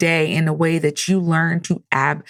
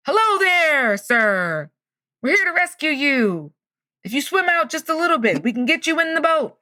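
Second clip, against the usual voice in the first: mock distant hail, pitched well up; calling out; 8 semitones higher than usual. Rescue crew hails man on the roof, calling out.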